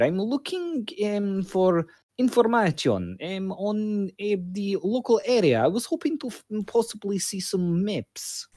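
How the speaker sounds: a meek traveller asking the librarian for maps; polite newcomer character. Acted. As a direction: play the lost foreigner, timid and polite. Plays his character.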